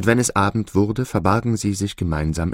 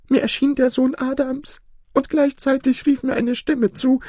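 very high-pitched goose voice; troubled goose storyteller. Acted. Goose telling the peacocks about her dream, troubled.

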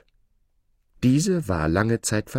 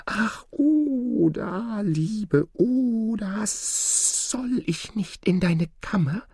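high, very drawn-out wheedling snake voice; pleading snake; 13 semitones higher, much slower than usual. A narrator's reading. Snake begs to lie at Oda's chamber door, pleading. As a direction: call high and slow, stretching the name out. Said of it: "da rief die Schlange wieder".

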